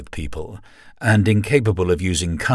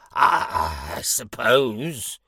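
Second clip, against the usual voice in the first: hesitant; much slower than usual. Acted. Confused demon concedes.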